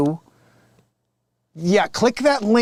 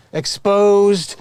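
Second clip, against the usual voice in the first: ordinary talk, nothing marked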